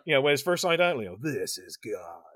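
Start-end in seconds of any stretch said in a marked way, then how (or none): 1.2-2.2 low, slow, soft imitation of a villain; non-modulated Robert Englund. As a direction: drop low and quiet, drawing the words out. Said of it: "basically a non-modulated Robert Englund".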